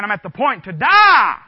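loud mock wail of dying flesh; a hungry body's loud complaint, crying out. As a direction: cry it out like a starving body. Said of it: "your flesh is crying out".

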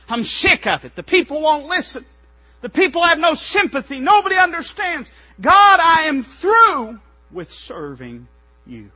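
loud, high preached outburst of frustration; fed-up rant. Acted. Preacher as Jeremiah quitting on God, exasperated shouting.

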